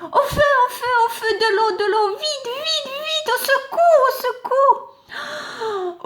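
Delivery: very high, shrill panicked calls; panicked cries for help. Acted. The burning clod crying for help, very high. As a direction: scream for help as your hair burns.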